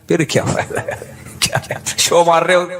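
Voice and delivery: chuckling while mocking the rich man's show; laughing tease. To the rich merchant, teasing him with a laugh.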